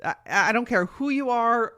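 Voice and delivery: usual speaking voice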